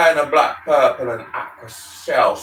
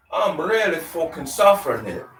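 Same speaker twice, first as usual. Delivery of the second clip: usual speaking voice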